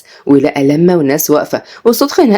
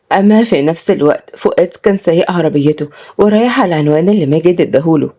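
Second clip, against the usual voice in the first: ordinary delivery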